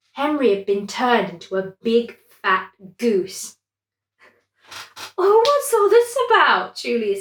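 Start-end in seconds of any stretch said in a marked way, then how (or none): 5.2-6.8 much higher, loud startled cry; shocked. Acted. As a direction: yelp in disbelief. Julius shocked at goose.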